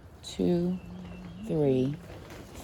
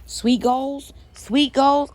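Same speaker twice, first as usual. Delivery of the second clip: excited; 7 semitones higher, 4 dB louder, much slower than usual. Real, not acted. Excited reading seed label.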